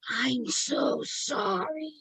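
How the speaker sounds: put-on gasping apology; gasping for breath. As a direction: labour through each word as if out of air. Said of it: "gasping for breath".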